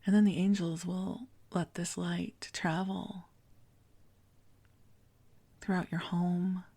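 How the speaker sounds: gentle meditation prayer voice; spa whisper. Acted. Leads guided prayer meditation.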